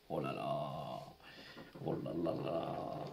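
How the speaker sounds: very low, drawn-out dismayed oh là là; low grumbling disappointment; deep groan. Real, not acted. Groaning at bad scratch card.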